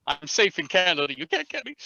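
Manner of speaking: high playful taunt; teasing. Real, not acted. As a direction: taunt them from safety.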